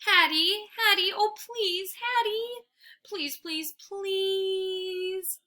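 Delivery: high, drawn-out whine; a dog whining to its girl for food; whining plea. A narrator's reading. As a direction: beg like a starving puppy. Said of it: "I whine some more".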